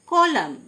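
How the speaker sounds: "কলাম" ending on M; silent N. Shown as a demonstration. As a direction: model "কলাম" with no final N. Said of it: "এন silent". ("কলাম", শেষে এন উচ্চারণ না করে)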